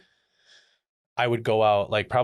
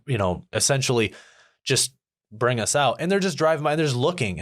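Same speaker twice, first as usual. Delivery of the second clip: usual speaking voice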